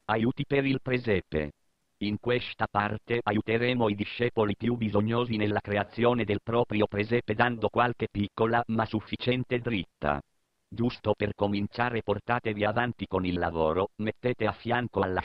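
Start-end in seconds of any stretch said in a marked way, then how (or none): none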